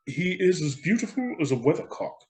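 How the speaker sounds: lightly voiced self-important councillor; a hint of a vain official showing off; pompous councillor. Councillor praising the statue to the crowd, lightly pompous. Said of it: "remarked one of the town councillors".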